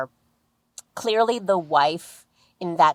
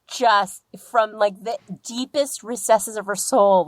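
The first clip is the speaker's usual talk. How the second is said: ordinary talk, nothing marked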